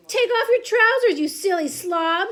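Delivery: loud, exasperated shout. A narrator's reading. Bystander to the panicking cook, shouting. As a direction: shout it as a scolding order. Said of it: "someone else shouted".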